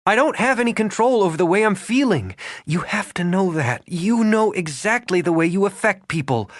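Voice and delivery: rising upset. A narrator's reading. Regan upset, near shouting.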